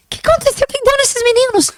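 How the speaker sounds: squeaky-high retold panic; high-pitched bewilderment. Overwhelmed teacher asking herself about the pupils, high-pitched bewilderment. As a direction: shoot the voice way up in mock despair.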